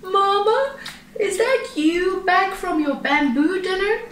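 high-pitched little panda voice; small sleepy child voice; sleepy cub calling. A narrator's reading. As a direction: call down to Mama in a high, drowsy voice. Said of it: "he called down sleepily".

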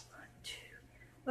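breathy counted whisper. Real, not acted. Mom counting softly by the fussy baby, whispered.

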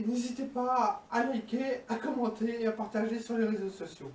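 ordinary talk, nothing marked

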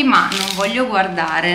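ordinary delivery

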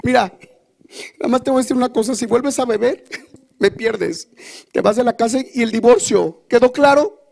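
advertencia aguda y enojada; amenázalo con voz alta y aguda; señora enojada. Acted. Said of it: "Cuando se enojan las señoras". (esposa enojada amenazando con el divorcio, aguda)

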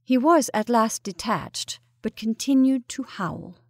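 usual speaking voice

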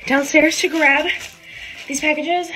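panting a little; out of breath. Real, not acted. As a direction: talk while catching breath. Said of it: "I'm really out of breath".

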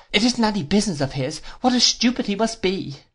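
put-on high-pitched childish sweetheart, complaining; petulant Dora. Dora objects to her sweetheart's friend, high and petulant.

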